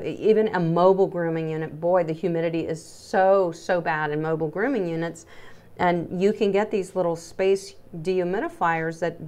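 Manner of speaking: ordinary delivery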